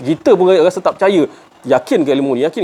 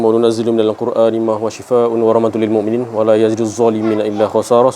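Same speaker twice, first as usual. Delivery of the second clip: Quran recitation; 4 semitones lower than usual. Acted. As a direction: recite the verse with tajwid. (bacaan ayat Al-Quran secara bertajwid)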